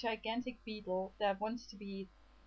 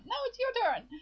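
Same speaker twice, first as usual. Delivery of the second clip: much higher mock sibling retort; higher bickering reply; 13 semitones higher, 3 dB louder than usual. Sister and Lucas over grandma's teeth, higher bickering retort. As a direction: squabble back in a high-pitched voice. The other sibling answers back.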